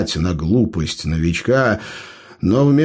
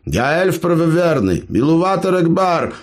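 proclaiming; 7 semitones higher than usual. A narrator's reading. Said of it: "провозгласил Эбер".

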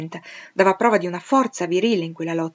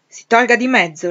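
loud, higher, commanding order; stern command; 5 semitones higher, 10 dB louder than usual. A narrator's reading. Rochester ordering Grace aside, loud and stern. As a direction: order her out of the way. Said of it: "le intimò il signor Rochester".

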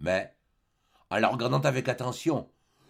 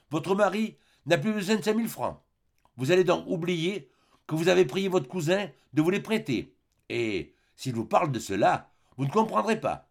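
higher, firmly projected instructions; hypnotic command; 7 semitones higher than usual. A narrator's reading. Doctor commanding the hypnotised cousin, insistent. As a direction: impress each order on the sleeper.

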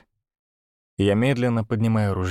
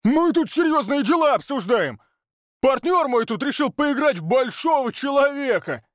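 very high, raging yell; angry rant; 14 semitones higher than usual. Acted. Oleg raging about his partner, angry yell. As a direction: sneer and rage at once.

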